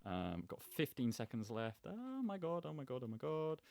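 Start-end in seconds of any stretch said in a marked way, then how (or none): 1.9-3.6 panicked excitement. Real, not acted. Panicking with seconds left.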